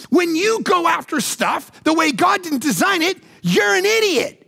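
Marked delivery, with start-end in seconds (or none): none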